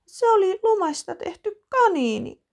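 put-on high, loud tearful child voice; girl explains through tears, high tearful child voice; sobbing. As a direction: sob it out. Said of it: "Iina nyyhkyttää".

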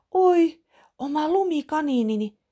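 high, loud cry of delight; girl thrilled by her snow rabbit, high excited cry; delighted child. Acted. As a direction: gasp it out with joy.

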